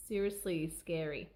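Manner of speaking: usual speaking voice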